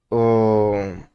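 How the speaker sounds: ordinary delivery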